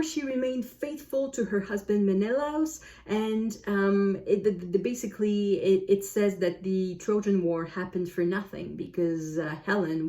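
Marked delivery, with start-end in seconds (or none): none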